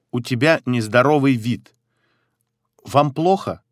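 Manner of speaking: usual speaking voice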